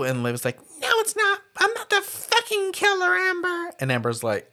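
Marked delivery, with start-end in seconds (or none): 0.8-3.7 high-pitched frantic denial, acted out; mock shouted denial. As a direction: squeak it out as a cornered girl. Liv shouting denial in retold scene.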